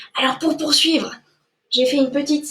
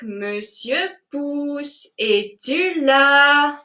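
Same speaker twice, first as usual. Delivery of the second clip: normal talking voice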